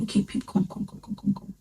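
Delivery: hushed, soft calling whisper. Retold or imitated. Whispering to call her friend aside. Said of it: "that's how I was whispering".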